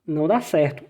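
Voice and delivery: ordinary talk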